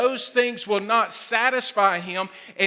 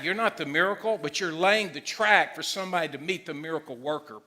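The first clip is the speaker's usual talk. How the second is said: usual speaking voice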